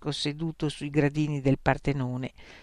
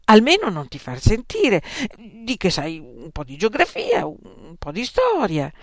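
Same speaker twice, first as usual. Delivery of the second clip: exasperated mother; 5 semitones higher, 5 dB louder than usual. Acted. Mother exasperated then pleading.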